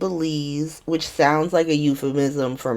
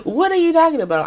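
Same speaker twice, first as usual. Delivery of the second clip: higher, fast, baffled laughing; baffled son; 9 semitones higher, faster than usual. Retold or imitated. Son hearing his mother's odd goodbye, baffled. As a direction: ask it utterly baffled. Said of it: "He's like".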